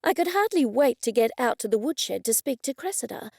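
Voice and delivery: usual speaking voice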